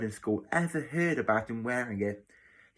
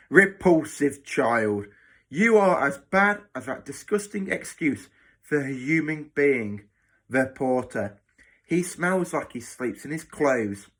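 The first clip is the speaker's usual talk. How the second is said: horrified disgust; 3 semitones higher, 5 dB louder than usual. Acted. Matron insulting the new boy and the porter, disgusted. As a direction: pour scorn on the child with heavy emphasis. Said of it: "Matron's lips curled in horror".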